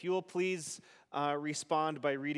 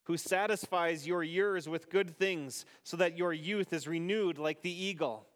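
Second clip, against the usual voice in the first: responsive psalm reading. Acted. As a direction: lead the psalm and leave room for the congregation.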